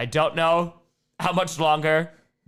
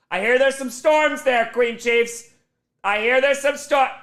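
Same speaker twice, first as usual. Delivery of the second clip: excited; 9 semitones higher, 5 dB louder than usual. Real, not acted. Reading big donation excitedly.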